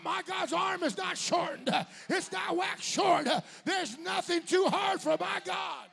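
loud, high, slow shouted preaching; preaching at full force. Real, not acted. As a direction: hammer each line to the congregation.

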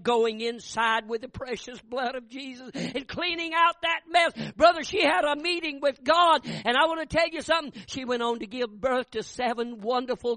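high, loud revival preaching; fiery preaching. Acted. Preacher thunders the testimony at his congregation. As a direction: preach it home to the crowd.

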